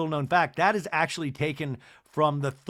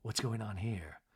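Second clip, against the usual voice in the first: worried mutter; 6 semitones lower, 9 dB quieter than usual. Retold or imitated. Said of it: "Heather's worried look".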